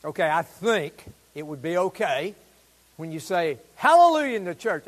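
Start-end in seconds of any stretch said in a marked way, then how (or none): 3.8-4.4 loud joyful call; shouted hallelujah. Shown as a demonstration. Preacher telling the church it's fine to shout hallelujah. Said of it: "okay to shout".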